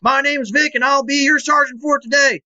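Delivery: barking like a drill sergeant. Acted. Podcast intro as drill sergeant.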